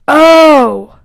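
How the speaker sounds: ordinary delivery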